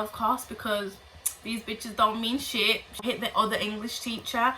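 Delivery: ordinary talk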